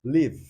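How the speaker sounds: "Live" with a short i, like "liv"; clipped demo "Live" rhyming with give. Shown as a demonstration. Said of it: "Esses sons são o que às vezes diferenciam". ("Live" com i curto)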